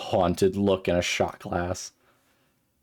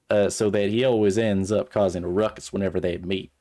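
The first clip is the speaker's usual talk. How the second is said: put-on Southern accent. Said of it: "Like a really Southern voice".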